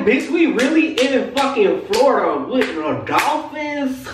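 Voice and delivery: high, drawn-out amazement; incredulous. Retold or imitated. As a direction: stretch out the disbelief in a high pitch. Retold astonishment at seeing dolphins.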